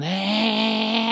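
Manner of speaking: high mocking jeer; a hammed-up rowdy jeer; mock class jeer. Class jeering at teacher.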